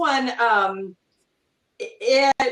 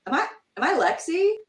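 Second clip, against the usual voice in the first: imitated brother; 6 semitones higher than usual. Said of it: "my brother's like".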